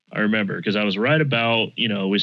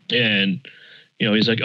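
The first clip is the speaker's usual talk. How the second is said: normal talking voice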